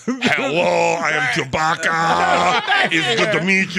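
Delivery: loud, drawn-out howl of an impression, badly done; hammed-up bad creature impression, shouted through laughter; Chewbacca. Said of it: "do your Chewbacca impression".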